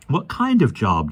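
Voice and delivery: put-on slow, insistent boy; demanding. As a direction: press him for an answer. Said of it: "demanded Michael".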